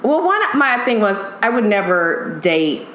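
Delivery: ordinary delivery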